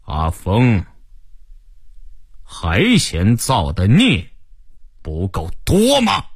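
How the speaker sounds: aged but firm elder, slow and weighty; slow stern old man, pitch lifting on the closing question; old, forceful voice. Acted. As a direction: rebuke him as a commanding old man, drawing out the words. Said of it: "一个苍老刚劲的声音".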